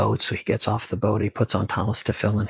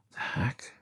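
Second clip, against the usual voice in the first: breathless whispered the heck; puzzled whisper; 11 dB quieter than usual. Acted. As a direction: murmur it to yourself, baffled. Man puzzles alone in cabin.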